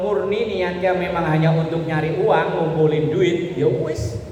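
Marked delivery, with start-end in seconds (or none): none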